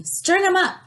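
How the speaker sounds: high, loud furious demand; a mob calling for punishment; angry shout. A narrator's reading. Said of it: "the men of the town shouted".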